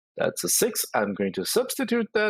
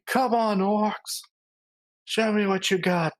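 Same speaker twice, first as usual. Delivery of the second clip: high-pitched, half-contained war cry; mock battle scream; 12 semitones higher than usual. Player charging the orcs in the game, high mock scream. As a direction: call it out high, playing at screaming. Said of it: "I will charge and I will be screaming".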